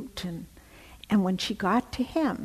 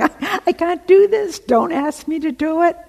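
loud, high, hammed-up panic; Corrie's protest, shouted high; frantic protest; 10 semitones higher, 11 dB louder than usual. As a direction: cry out against what God asks. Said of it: "she's protesting".

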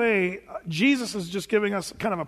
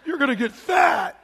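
much higher, loud, half-laughing fake panic; mock alarmed reaction; 8 semitones higher, 5 dB louder than usual. Mocking what nobody tells an expectant mom, in loud laughing alarm. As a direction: ham up a horrified friend hearing pregnancy news. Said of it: "nobody goes".

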